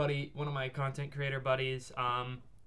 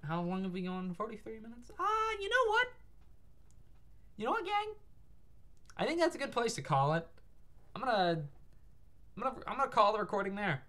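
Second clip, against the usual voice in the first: high voice; 10 semitones higher than usual. Pretend. Voicing the end of the stream.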